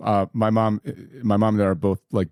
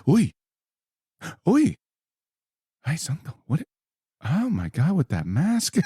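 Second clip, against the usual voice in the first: imitating mom's shocked Puerto Rican exclamations, high; high, startled Puerto Rican mom at the trailer; mom's gasp; 6 semitones higher than usual. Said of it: "she was kinda like".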